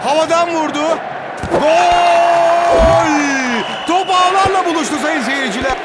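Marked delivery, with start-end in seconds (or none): 1.5-3.1 screaming football commentator. Acted. Goal call in football game.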